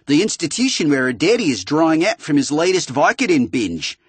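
put-on laying it out in a crappy Australian accent; an Australian computer voice pushing Marcus to leak. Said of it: "the Australian voice said again".

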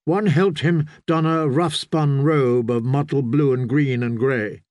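ordinary delivery

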